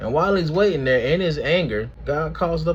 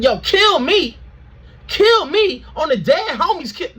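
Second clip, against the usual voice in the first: dramatic despair; 12 semitones higher, 5 dB louder than usual. Acted. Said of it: "But then he's like".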